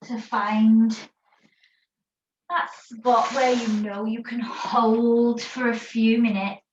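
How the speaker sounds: calm, unhurried yoga teacher voice guiding a rest pose; guided meditation. Acted. Yoga teacher guiding the class in child's pose, guided meditation voice. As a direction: ease the class into stillness, word by word.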